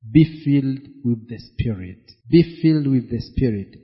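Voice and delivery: normal talking voice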